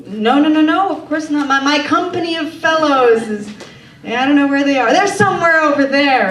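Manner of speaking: high, loud, hasty denial; defensive bluff. Retold or imitated. Nervously bluffing to the stranger.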